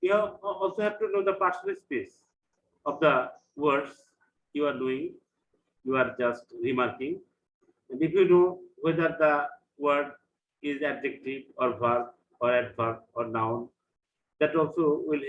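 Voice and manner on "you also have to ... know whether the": ordinary delivery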